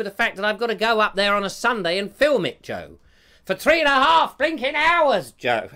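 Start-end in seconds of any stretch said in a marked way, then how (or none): 3.4-5.7 loud, high comic protest; mock exasperated outburst. Grumbling to Joe about hours of filming, mock exasperated outburst. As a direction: burst out in mock outrage, pitched right up.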